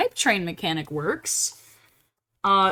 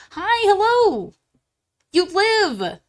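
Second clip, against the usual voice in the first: high, drawn-out excited hello; delighted greeting; 10 semitones higher, 4 dB louder, slower than usual. Real, not acted. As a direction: greet a friend with a burst of joy. Delighted greeting to arriving viewer.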